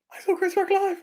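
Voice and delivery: very high fan squeal; full-voiced squeaky glee; giddy boast. Real, not acted. Repeats the gleeful announcement, higher.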